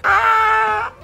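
a high squeal bursting into a laugh. Real, not acted. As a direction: shriek with delight, then crack up laughing. Squeals at seeing the dog.

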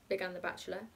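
ordinary delivery

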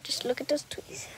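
secretive whisper. Shown as a demonstration. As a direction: whisper the hint. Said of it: "That's all you need to know".

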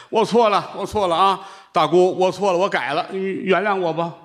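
high, eager apology; apologising seller. Acted. Seller apologising to the nuns, high and eager.

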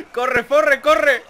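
laughing panic, loud; being chased. Real, not acted. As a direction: giggle through the panic. Fleeing while laughing.

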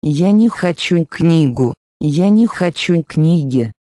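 ordinary talk, nothing marked

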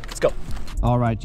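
usual speaking voice

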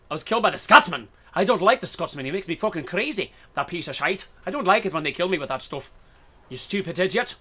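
hammed-up, clumsy Scottish accent; bad Scottish accent. Gamer grumbling at being killed, bad mock Scottish accent. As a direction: rant in a badly faked Scottish brogue. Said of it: "That was a really bad Scottish accent".

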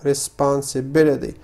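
usual speaking voice

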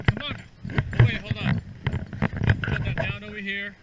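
normal talking voice

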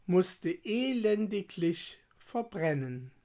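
ordinary delivery

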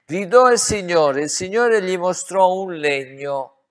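loud, high, booming cry; fervent pulpit proclamation; preaching shout. Real, not acted. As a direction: thunder it out to the congregation.

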